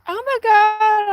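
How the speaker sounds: high, slow, drawn-out "Oh my God"; surprised gasp. Retold or imitated. Friend on the phone, mock-surprised and high. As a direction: caller imitated, stretching it out in surprise. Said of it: "she's like".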